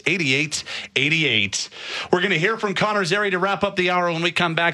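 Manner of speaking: lively radio sign-off; radio host read. Acted. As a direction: sell the sponsor, then tease the next segment.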